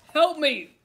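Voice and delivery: huge booming cry for help; roaring giantess. A narrator's reading. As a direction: roar it out like a giant woman. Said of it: "the huge woman roared".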